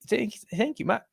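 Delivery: high, soft, faltering South African attempt; attempted South African accent. Streamer retrying thanks to a viewer, failed South African accent. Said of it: "I, I can't do it".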